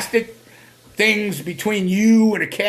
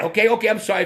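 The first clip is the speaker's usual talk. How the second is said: normal talking voice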